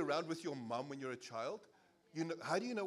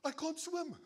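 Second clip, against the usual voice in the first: joke answer; 12 semitones higher, 4 dB louder, slower than usual. Retold or imitated. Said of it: "He's like".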